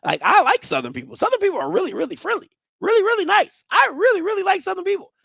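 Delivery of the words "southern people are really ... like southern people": high, loud gushing; higher, emphatic, almost mock sweet; emphatic praise. Real, not acted. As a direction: gush over them a bit much. Overdone praise of Southern people.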